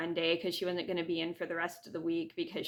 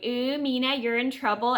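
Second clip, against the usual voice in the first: mock kindergarten taunt, pitched up a bit; teasing singsong; 5 semitones higher, 4 dB louder than usual. Retelling a classmate's Zoom taunt, mock teasing. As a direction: tease like a kid on the playground. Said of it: "starts teasing me and going".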